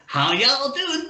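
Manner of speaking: high, drawn-out American drawl; exaggerated American accent impression. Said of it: "he's talking like [name]".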